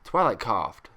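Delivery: ordinary delivery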